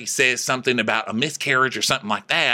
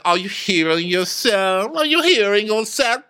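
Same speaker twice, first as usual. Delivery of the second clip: mocking; 7 semitones higher than usual. Diana ridiculing Sutton in a fight, rising mockery. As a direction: jeer it, climbing higher on the repeat. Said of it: "Diana starts laughing, and she's like".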